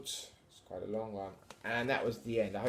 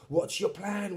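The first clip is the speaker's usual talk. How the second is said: ordinary delivery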